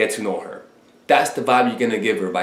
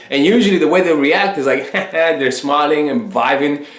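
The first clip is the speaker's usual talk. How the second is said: ordinary delivery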